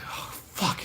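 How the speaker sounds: whispered mock agony; pained kid. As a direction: breathe the curse out voicelessly. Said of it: "You're like".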